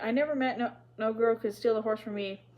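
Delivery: ordinary delivery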